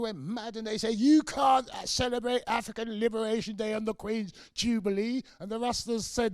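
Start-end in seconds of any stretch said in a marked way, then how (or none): 0.0-5.2 loud, high, indignant quote; mock outrage. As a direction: play the outraged establishment.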